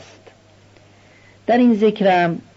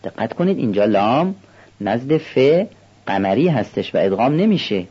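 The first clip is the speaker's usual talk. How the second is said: usual speaking voice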